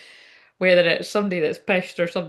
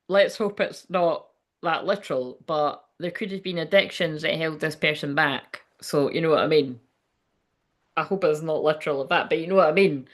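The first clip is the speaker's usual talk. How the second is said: normal talking voice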